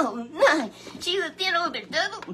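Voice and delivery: high, loud shocked cry then question; astonished gasp. Acted. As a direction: gasp it out in disbelief. Puppet gasps at the story.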